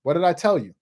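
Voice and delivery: usual speaking voice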